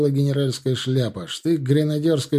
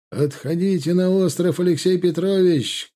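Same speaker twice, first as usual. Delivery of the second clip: high, moderately raised call; shouted urging; 6 semitones higher than usual. A narrator's reading. General tells Alexei to withdraw, shouted urging. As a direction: call across the field, urging retreat. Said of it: "крикнул Михаил Андреевич".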